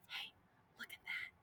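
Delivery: whispered aside; hushed whisper. A narrator's reading. Storyteller whispers an aside to listeners. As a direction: breathe it like a secret.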